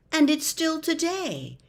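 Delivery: high, loud cry of amazement; can't believe it, crying out in wonder; amazed cry. Acted. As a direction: call it out, astonished. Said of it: "he cried in amazement".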